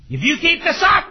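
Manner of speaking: loud, high parental shout; mock angry threat. Parent threatening a misbehaving child, hammed-up shout. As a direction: bellow an empty threat at a child.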